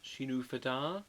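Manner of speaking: Shinu Fada, drawn out as a question; trying corrected pronunciation. Shown as a demonstration. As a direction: try "Shinu Fada" as shee-noo fa-DA, rising at the end.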